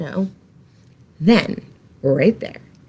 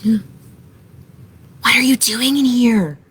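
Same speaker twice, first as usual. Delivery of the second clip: bunny whisper; 3 semitones higher, much slower than usual. A narrator's reading. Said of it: "whispered one of the bunnies".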